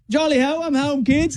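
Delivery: put-on high, hammy British accent; cheery British accent dad coming home. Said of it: "but he's like".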